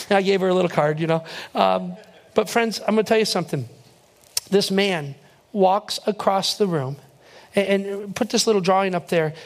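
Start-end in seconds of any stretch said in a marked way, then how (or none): none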